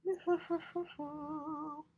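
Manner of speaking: high squeaky meow melting into giggles; kitten meow laugh. Pretend. Owner playing kitten for the camera, high meow and giggle. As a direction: meow then giggle in a tiny voice.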